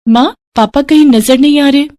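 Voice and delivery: put-on a small girl looking for her papa; little girl voice. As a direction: daughter asking her stepmother, childlike. Narrator voices young daughter.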